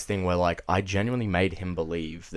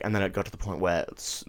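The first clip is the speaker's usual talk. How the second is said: usual speaking voice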